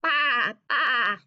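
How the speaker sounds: loud bleating baa; sheep bleat. Acted. Teacher reading to class, a loud sheep baa. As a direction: bleat it out like a lamb. Sheep noise read in picture book.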